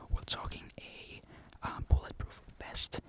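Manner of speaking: ordinary delivery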